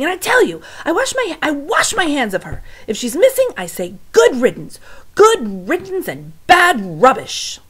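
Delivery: high, loud, dismissive yelling; Louise shouting. Acted. Louise yelling about missing Mig, loud and high. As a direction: shout her off, washing your hands of her. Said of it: "Louise was shouting".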